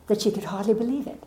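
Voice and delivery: normal talking voice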